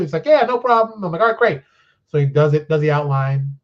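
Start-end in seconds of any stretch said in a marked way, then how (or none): 0.3-1.0 higher, louder breezy eager reply; eager yes. Retold or imitated. Quoting Jay's eager reply. 1.2-1.6 higher, louder upbeat approval; pleased reply. Retold or imitated. Quoting own pleased reply.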